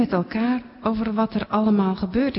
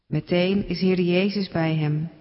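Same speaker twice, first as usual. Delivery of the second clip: ordinary delivery, nothing marked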